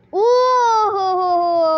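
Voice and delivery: long, loud high "oh"; big swooping cry of surprise; startled cry. Real, not acted. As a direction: wail out a drawn-out oh. Cries out in game.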